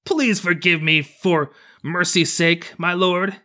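high, drawn-out tortured pleading; strange, harsh kappa cry. A narrator's reading. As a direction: beg slowly in a high, strained creature voice. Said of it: "began to cry in a strange, harsh voice".

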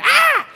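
sudden high squeal of fright; girlish scream. Retold or imitated. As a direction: shriek like a scared little girl. Daughters screaming when scared.

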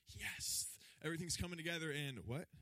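excited then baffled. Retold or imitated. Disciples' voiced confusion.

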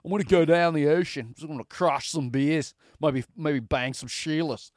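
hammed-up Australian imitation; joking, exaggerated Australian twang; thick Aussie accent. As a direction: put on a broad mock Aussie accent. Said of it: "thick Aussie accent".

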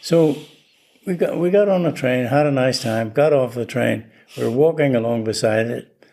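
ordinary talk, nothing marked

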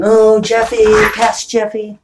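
mock whine at past self; whiny lament to past Jeffy; a hammed-up whine; whining.

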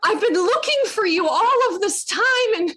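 high, gushing and breathless; overexcited dream reunion. Retold or imitated. Greeting a missed friend at last, high and gushing. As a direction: pour it out like finding her in a dream. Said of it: "Literally a lunatic".

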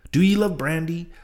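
high, loud taunting call; goading call. Acted. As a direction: egg the rowers on loudly. Stubb goading his crew.

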